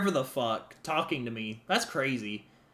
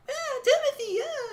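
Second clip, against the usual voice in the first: put-on much higher childlike voice; kid voice. Imagined kid insisting on Timothy, high childlike. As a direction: chirp it like a small kid. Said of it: "kid insisting on name".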